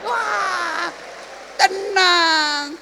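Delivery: high, loud, drawn-out relief; blissful exclamation. Acted. Preacher showing how soothing the song is, exaggerated relief.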